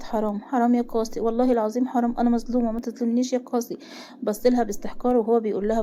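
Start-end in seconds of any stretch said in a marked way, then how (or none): none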